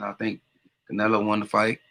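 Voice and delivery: usual speaking voice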